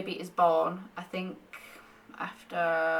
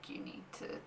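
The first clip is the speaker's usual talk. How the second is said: hushed whisper as the body's voice; 13 dB quieter than usual. Retold or imitated. Her body telling her to slow down, whispered. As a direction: whisper it like your body telling you off gently. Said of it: "my body's just like".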